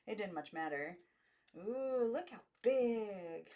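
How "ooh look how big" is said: higher, louder, very slow on look how big; drawn-out girlish amazement; awed girls. A narrator's reading. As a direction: marvel like little girls.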